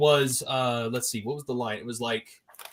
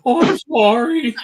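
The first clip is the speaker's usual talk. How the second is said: mock apology; 9 semitones higher, 6 dB louder than usual. Mocking apology echo.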